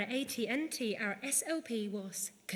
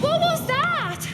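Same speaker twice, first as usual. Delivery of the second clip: startled; 12 dB louder, slower than usual. Real, not acted. Sudden explosion nearby.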